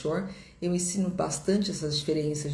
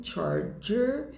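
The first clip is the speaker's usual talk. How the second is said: American "charger", both Rs sounded, pitched up; sotaque americano; 6 semitones higher than usual. Shown as a demonstration. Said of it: "Em inglês americano". (sotaque americano, tom mais alto, R marcado)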